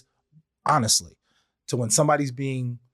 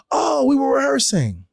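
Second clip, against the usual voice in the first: realizing oh; 12 semitones higher, 4 dB louder than usual. Retold or imitated. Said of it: "I'm like".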